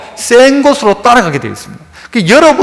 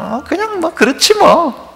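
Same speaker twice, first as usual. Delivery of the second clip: half-hearted shrug; 8 semitones higher, 4 dB quieter than usual. Acted. Lukewarm believer's half-hearted answer.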